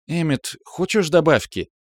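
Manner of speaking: high, eager-to-please offer; obliging. A narrator's reading. Сумира offers Эммет a second helping, obligingly. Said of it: "услужливо спросила Сумира".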